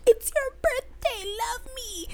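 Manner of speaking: very high, slow mock squeal; high squeaky voice. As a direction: squeal it like a cartoon. Friends' greeting acted out.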